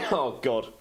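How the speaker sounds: sharp coughing burst; choking cough. Real, not acted. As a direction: choke and sputter on a drink. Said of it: "choking on my drink".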